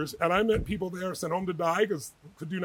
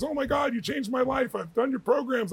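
high-pitched gushing; retold excitement, pitched well up; excited fan; 7 semitones higher than usual. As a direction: gush like a thrilled fan meeting his hero. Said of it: "was excited when he met me".

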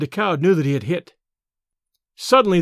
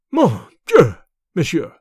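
louder outburst, dropping on the second part; astonished cry; 5 dB louder, slower than usual. A narrator's reading. Astonished Decaud to the man offering his pistol, shocked cry. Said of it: "cried the latter".

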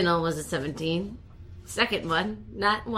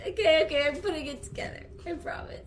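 giggling through the words; laughing; 9 semitones higher, 5 dB quieter than usual. Real, not acted. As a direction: laugh while you talk. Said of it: "a constant giggle".

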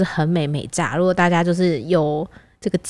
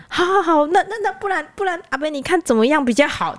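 higher, flustered imitation of herself; flustered pleading; 8 semitones higher than usual. The driver placates the uncle, higher flustered tone. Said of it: "因为我已经慌了手脚".

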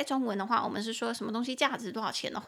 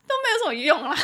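higher, louder, breaking into real laughter; amused laughing; 12 semitones higher, 8 dB louder, much faster than usual. Real, not acted. Teacher admitting friend advice rarely works, laughing. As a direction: laugh through the admission.

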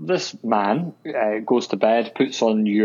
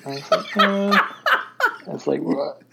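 high, laughing disbelief; 8 semitones higher than usual. Real, not acted. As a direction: laugh while you tell it. Laughs at being recognized.

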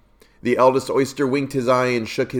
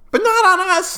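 much higher, louder tiny oyster cry; squeaky little oysters; 5 dB louder than usual. Acted. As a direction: squeal it like a small shellfish. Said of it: "the oysters cried".

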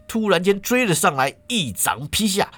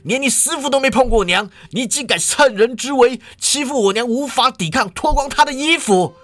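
enraged tirade climbing higher and higher; angrier bellow; 6 semitones higher than usual. A narrator's reading. Son rebuking the man who undressed his mother, angry bellow. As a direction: explode and build in outrage. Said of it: "语气更怒，大喝".

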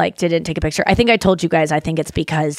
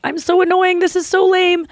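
high-pitched mock fan voice; tearful fan imitation; 12 semitones higher than usual. Crying fan apologizing at meet and greet, high imitated voice. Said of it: "a lot of times they're like".

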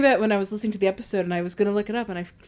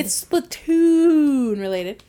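slow, louder, grand; dramatic announcement; 5 dB louder, much slower than usual. Acted. Repeats with showy announcement.

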